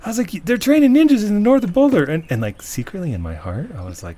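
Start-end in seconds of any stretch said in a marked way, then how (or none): 0.5-2.0 high, loud crazed street warning; frantic yelling. Retold or imitated. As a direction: imitate a man yelling wild news. Homeless man's warning, retold.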